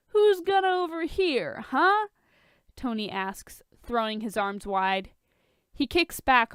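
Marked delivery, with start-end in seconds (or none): 0.1-2.1 teasing. Acted. Tony teasing Bruce.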